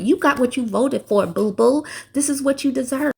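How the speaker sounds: gloating taunt with boo-boo; mocking scorn. Real, not acted. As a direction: sneer it at them, no pity. Mocking Trump voters.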